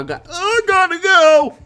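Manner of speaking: very high, drawn-out yell; panicked scream. Real, not acted. Player fleeing the killer, panicked scream. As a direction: scream it as you bolt.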